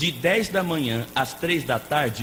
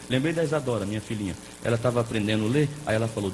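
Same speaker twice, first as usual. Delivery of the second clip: ordinary talk, nothing marked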